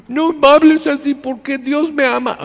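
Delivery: high, loud, hammed-up teary whine; mock tearful whine. Believer whining at an insulter, mock tearful whine. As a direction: whimper it like a wounded believer near tears. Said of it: "y no necesito con lágrimas".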